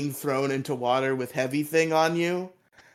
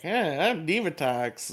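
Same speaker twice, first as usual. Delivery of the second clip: Diva Talks voice. Retold or imitated. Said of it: "she always does that voice on purpose".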